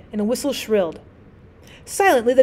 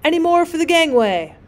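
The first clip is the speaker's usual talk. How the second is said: raised, higher call across the deck; shouted call; 6 semitones higher, 4 dB louder than usual. A narrator's reading. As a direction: call out to the last passengers. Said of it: "a voice like a cry shouted".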